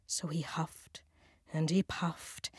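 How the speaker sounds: soft whispered huffing. A narrator's reading. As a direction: breathe it out in a whisper. Narrating the huffing.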